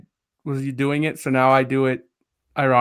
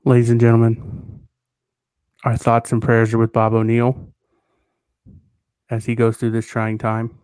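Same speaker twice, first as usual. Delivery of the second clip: formal announcement; 3 semitones lower than usual. Pretend. Mock formal closing statement.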